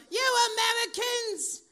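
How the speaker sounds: loud, high-pitched, drawn-out British outrage; yelling British husband. Retold or imitated. Mocking husband's dinner-table tirade, high British yell. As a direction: declaim it like an indignant Englishman, shouting. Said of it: "and he'll yell".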